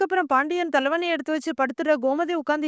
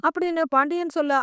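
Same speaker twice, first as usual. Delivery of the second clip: ordinary delivery, nothing marked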